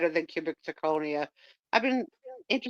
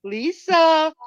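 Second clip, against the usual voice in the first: excited; 10 semitones higher, 7 dB louder than usual. Real, not acted. Calling out auction winner.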